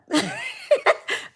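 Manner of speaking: very high giggle; high laugh. Real, not acted. Laughing after calling road ragers jerks, high giggle.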